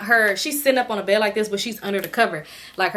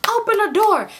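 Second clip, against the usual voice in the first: banging demand; 11 semitones higher than usual. Retold or imitated. Guy pounding on the hotel door, yelling to open up. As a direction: demand they open it now. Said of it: "he start banging on the door".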